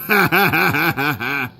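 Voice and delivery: short burst of cackling; mocking laughter. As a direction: crack up at your own joke. Laughing at Oliver's name.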